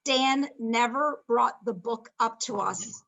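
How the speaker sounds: usual speaking voice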